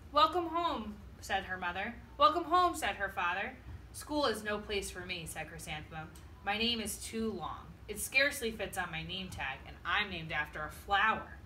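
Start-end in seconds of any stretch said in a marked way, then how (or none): none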